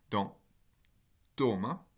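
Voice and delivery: ordinary talk, nothing marked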